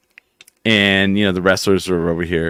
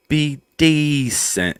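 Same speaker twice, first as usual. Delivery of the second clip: very slow, stretched vowels, pitched up; drawn-out words; 7 semitones higher, much slower than usual. Shown as a demonstration. Asking about an accent with long words, drawn-out and high. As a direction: stretch each word right out. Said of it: "like, the long words?".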